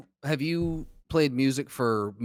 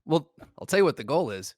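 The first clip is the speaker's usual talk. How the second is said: ordinary talk, nothing marked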